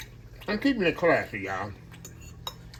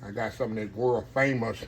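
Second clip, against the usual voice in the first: normal talking voice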